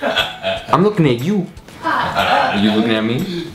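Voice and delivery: mock New York tough talk; Brooklyn accent. Friends confront each other in jest, New York accent. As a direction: challenge them in a playful Brooklyn accent. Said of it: "Brooklyn, New York".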